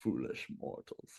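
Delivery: low, slow, whispered evil overlord; hammed-up villain whisper; dark lord. Mock villain after big bad talk.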